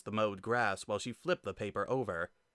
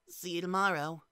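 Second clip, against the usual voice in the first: put-on child goodbye; 8 semitones higher than usual. Frisk saying goodbye.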